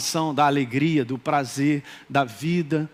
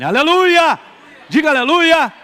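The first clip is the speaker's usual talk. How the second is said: shouted, much higher praise; vigorous hallelujah; 7 semitones higher, 9 dB louder than usual. Real, not acted. Pastor calling the church to shout hallelujah, full voice. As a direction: lead the crowd in a roaring cheer. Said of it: "Diga um vigoroso aleluia".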